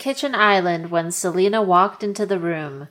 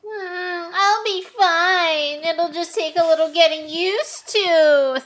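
much higher whine, stress on wear; whiny high Thea voice; 9 semitones higher than usual. Acted. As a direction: whine it like a sulky child. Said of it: "Thea mumbled".

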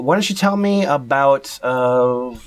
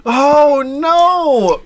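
very high, loud mock wail; mock horror; 4 dB louder than usual. Co-host asks the poster for his signature, wailing mock horror. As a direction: wail it in exaggerated dismay.

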